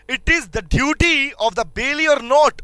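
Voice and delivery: very slow, rising question; teacher drawing out the question for students; slow dictation. Shown as a demonstration. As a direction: spell the question out slowly. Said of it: "posing the question slowly".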